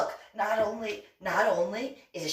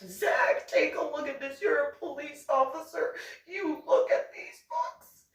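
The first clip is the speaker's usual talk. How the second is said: high, squeaky mock pleading that climbs higher; a shrill parody tattling to a brother; mocking Anna; 11 semitones higher than usual. Anna urging police officer Zach to read the books, high mock squeal. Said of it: "and be like".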